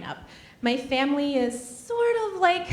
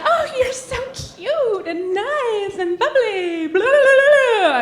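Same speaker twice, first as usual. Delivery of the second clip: high, slow, sugary gush; mock girly gushing; gushing fan; 11 semitones higher, 5 dB louder, slower than usual. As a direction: coo it all bubbly and sweet. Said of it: "they're like".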